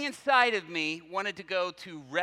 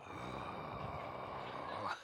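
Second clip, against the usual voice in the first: long, low exhale; acted sigh; 12 dB quieter than usual. Reacting to the woman touching her classmate, long sigh. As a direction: let out a drawn-out sigh.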